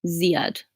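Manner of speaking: "Ziad" repeated with "zee" as the first syllable; "Ziad" said carefully, zee-ad; corrected pronunciation. Shown as a demonstration. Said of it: "you go down on the Z".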